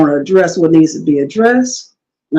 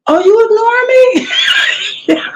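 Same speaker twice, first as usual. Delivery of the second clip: higher, mock-indignant "you ignore me?"; mock offended coworker; 14 semitones higher, much faster than usual. Imitating the coworker who feels ignored, mock indignant. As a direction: play the affronted coworker, pitched up.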